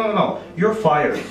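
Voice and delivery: normal talking voice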